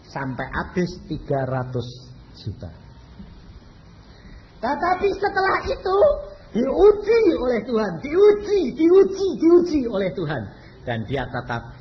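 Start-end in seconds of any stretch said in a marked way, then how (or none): 4.6-10.4 each diuji higher and louder; building emphasis. Acted. Preacher drives home the testing, rising loud repeats. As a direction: climb with every repeat, pressing the point.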